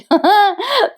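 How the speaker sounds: short high laugh of delight; amused laugh. Real, not acted. Narrator laughing at the serial's character, short high laugh.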